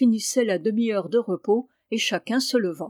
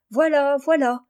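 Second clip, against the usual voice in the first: a louder, higher call across the field; 5 semitones higher, 6 dB louder than usual. Acted. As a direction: shout back to the father far off. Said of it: "cria-t-il".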